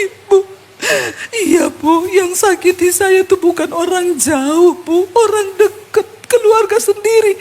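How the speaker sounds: hammed-up tearful wail, slow and broken by sobs; mock crying. Woman confesses her own family hurt her, mock crying. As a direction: imitate a woman sobbing out her hurt, high-pitched.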